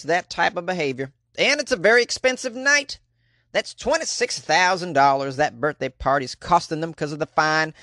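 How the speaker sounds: attempted Australian accent, clearly not landing; failed Australian accent. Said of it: "that's not an Australian accent".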